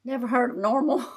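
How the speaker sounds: normal talking voice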